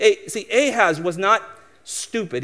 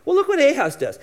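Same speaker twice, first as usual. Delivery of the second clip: usual speaking voice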